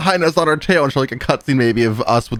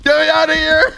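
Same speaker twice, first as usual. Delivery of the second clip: panicked plea; 4 dB louder than usual. Real, not acted. Fleeing the killer in game.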